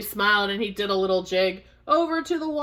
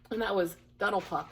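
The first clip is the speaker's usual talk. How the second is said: ordinary delivery, nothing marked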